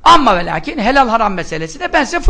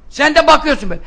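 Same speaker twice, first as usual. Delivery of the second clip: normal talking voice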